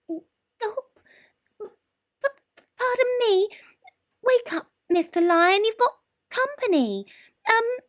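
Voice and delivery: very high, trembling mouse with squeaks; nervous squeaky mouse. A narrator's reading. Mouse nervously waking the lion to ask for roar lessons. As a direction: stammer it out, scared stiff.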